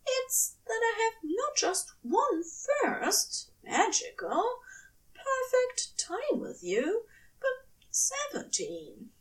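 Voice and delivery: high, hushed devoted angel voice; tender angel. A narrator's reading. Angel vowing to his lover, high and hushed.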